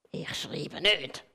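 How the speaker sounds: low, soft, slow grumble; grumbling. A narrator's reading. The gold pen grumbles at the red one, low and slow. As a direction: growl it out sulkily, low and slow. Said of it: "rummelt der Goldig".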